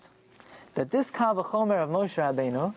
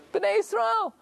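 eager answer; 14 semitones higher, slower than usual. Pretend. Teacher voicing the class, eager answer. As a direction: answer high and bright, like a keen student. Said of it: "What would you say?".

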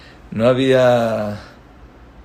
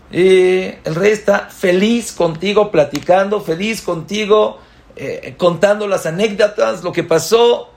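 alto y fuerte, contándolo con gusto; entusiasmado; 8 semitones higher, 5 dB louder than usual. Acted. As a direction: pinta al rey feliz con emoción. Escena con el rey. (el predicador describe al rey contento, alto y animado)

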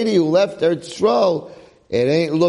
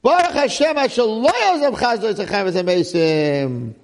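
joyful thanks; 8 semitones higher than usual. Retold or imitated. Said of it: "Naomi says to her daughter-in-law".

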